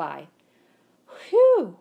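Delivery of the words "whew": relieved whew. A narrator's reading. Narrow escape in the street.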